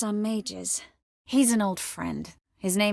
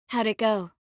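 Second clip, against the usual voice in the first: ordinary talk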